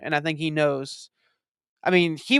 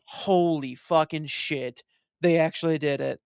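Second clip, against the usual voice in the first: slow stunned disbelief; slower than usual. Retold or imitated. Retells stunned reaction.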